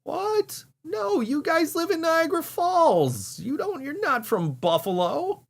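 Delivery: can't believe what they're hearing; squeaky high disbelief; incredulous. Real, not acted. Incredulous at the band.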